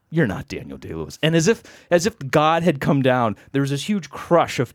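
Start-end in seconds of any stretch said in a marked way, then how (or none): 0.1-1.2 low, soft verdict; imitated unimpressed doubter, pitched down; deadpan contradiction. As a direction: quietly call the bluff. Sceptic flatly contradicts him.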